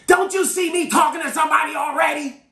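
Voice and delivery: high, loud irritated yell. Retold or imitated. Teller yelling at a waiting drive-thru customer. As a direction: imitate a teller yelling over an intercom. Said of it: "she yelled at me".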